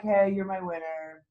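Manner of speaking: low, soft and slow, no excitement at all; bored flat call. Shown as a demonstration. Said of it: "You gotta be excited".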